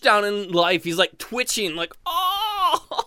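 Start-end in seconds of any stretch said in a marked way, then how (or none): 2.0-3.0 high, drawn-out moan of a crushed elf; long high wail of pain; pained groan. Acted. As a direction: groan in comic agony. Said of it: "in constant pain".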